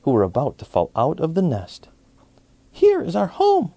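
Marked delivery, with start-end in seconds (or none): 2.7-3.7 very high, slow chick voice; little robins. A narrator's reading. Baby robins tell the bunny their home, very high. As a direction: squeak it like baby birds. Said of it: "the little robins".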